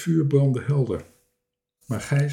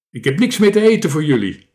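loud, quick, raised voice; angry shout; 4 semitones higher, 7 dB louder, faster than usual. A narrator's reading. Strange man refusing to feed visitors, shouting. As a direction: shout them away crossly. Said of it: "schreeuwde hij".

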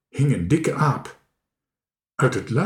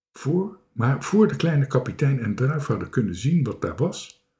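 ordinary delivery, nothing marked

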